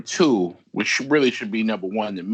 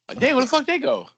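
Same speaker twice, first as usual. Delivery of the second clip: mock surprise; 13 semitones higher, faster than usual. Voicing confused neighbors.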